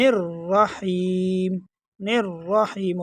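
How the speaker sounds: الرحیم slowly, as "nir-raheem"; joined nun and ra. Shown as a demonstration. Teacher showing nun joined to ra, slow "nir-raheem". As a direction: run n straight into a full, held r in الرحیم. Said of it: "نون اور را کو دونوں کو ملا کر پڑھیں گے".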